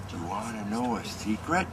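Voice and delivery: softly, a hushed secret offer. A narrator's reading. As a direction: lean in and half-whisper it. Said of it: "he whispered in her ear".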